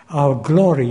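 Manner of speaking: ordinary delivery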